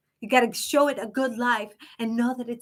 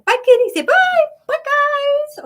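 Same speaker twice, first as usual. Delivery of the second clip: much higher and louder, squealing bye to the kitty; squealy goodbye; 8 dB louder than usual. Real, not acted. Waving the cat goodbye.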